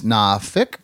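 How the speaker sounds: "nah fick" with no R or L, short "fick"; local pronunciation. Shown as a demonstration. Host showing how locals say Norfolk, "nah fick" with dropped R. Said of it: "the proper pronunciation".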